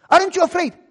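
high, loud threatening question; police shout. Retold or imitated. Officer shouting at fearless father. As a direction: bark the threat.

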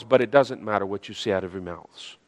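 careful, subdued qualification. Shown as a demonstration. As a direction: drop "doesn't matter" down like a careful aside. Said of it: "be careful on how I say this".

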